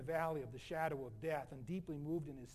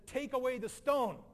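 loud, higher-pitched order; commanding; 5 semitones higher, 8 dB louder than usual. Acted. Jesus at the tomb ordering the stone moved, commanding. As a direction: call it out firmly as a command.

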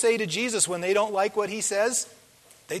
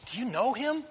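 hushed, higher-pitched question; wife's aside; 4 semitones higher, 5 dB quieter than usual. Acted. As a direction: softly imitate a wife asking discreetly. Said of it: "your dear wife saying".

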